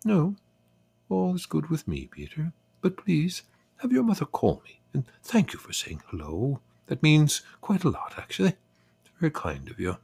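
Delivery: high, gracious English lady's voice; composed English accent, pitched well up; calm English accent. A narrator's reading. Lonely neighbour thanking Peter, calm English accent. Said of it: "said in her calm English voice".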